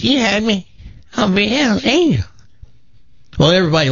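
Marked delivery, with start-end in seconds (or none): 0.0-2.2 high, put-on old lady voice, defiant; an eighty-eight-year-old demanding her place; feisty old granny. Granny telling the Hells Angels she wants in, in a high put-on old voice. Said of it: "She says".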